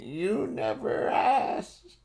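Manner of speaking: put-on squeaky, slow old man; drawn out in a wobbly voice; high slow old man voice. Toots as old man answers.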